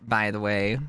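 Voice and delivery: ordinary talk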